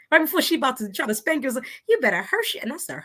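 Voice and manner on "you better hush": high, sweet Southern warning; Cousin Sue. Retold or imitated. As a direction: imitate an older Southern cousin scolding. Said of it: "sounds so sweet with that Southern accent".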